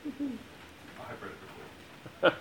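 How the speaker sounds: breaking up, barely holding a straight face; fighting a giggle before reading; giggling through the first words; stifled laugh. Real, not acted. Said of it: "keep a straight face".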